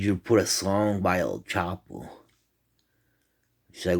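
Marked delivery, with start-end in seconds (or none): none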